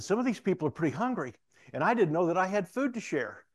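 high, loud, amazed retelling; animated. Retold or imitated. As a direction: imitate the inmate bubbling over with his discovery. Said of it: "he becomes so animated".

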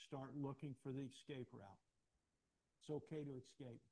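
ordinary talk

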